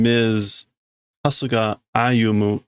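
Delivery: usual speaking voice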